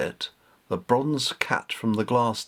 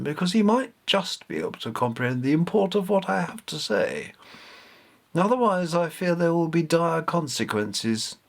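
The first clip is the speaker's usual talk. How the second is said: a higher, grave goddess voice giving an order; commanding cat goddess; 6 semitones higher than usual. Acted. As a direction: warn of doom, then command. Bastet addresses Grandpa, commanding.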